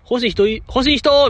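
high-pitched mock call to the room. Host asks the group who wants the clothes, calling out high. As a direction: imitate a host calling for takers, voice jumping up.

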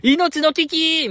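high, drawn-out mock cry of alarm; サメ発見の一言の真似. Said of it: "サメを発見した時の一言も良かったじゃないですか". (高く伸ばした大げさな危機の叫び真似)

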